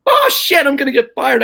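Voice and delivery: high, sudden alarmed yelp; sharp high mock alarm at being fired; panicked thought. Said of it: "I'm like".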